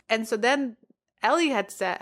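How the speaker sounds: usual speaking voice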